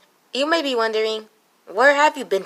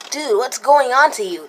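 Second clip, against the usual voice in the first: ordinary talk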